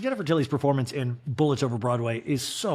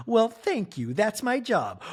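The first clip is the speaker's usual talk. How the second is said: higher, sweet reply; lightly voiced Jennifer Tilly; 7 semitones higher than usual. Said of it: "And Jennifer's like".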